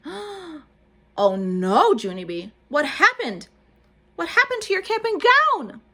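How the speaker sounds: high, loud alarmed question; shocked girl. A narrator's reading. Grace horrified at Junie's spotted gown, high and loud. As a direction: cry out in dismay.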